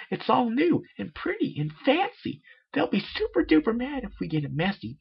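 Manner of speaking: very high, bubbly cartoon pony; Pinkie Pie voice. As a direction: squeak it out like a hyper party pony.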